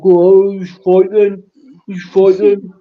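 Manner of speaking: imitating a drunk mate slurring. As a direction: drunk driver slurring about a fight, mock slurred. Drunk driver voiced in story.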